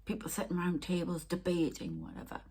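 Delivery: ordinary delivery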